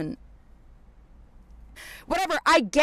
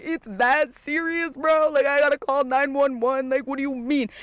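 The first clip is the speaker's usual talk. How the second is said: retold disbelief; 5 semitones higher than usual. Told by mom to call emergency services, acting out disbelief. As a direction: stretch it out in mock disbelief.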